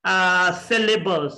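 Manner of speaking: ordinary talk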